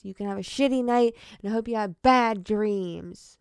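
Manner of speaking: loud, spiteful send-off; mock curse. Real, not acted. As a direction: shout the curse at them. Cursing the police.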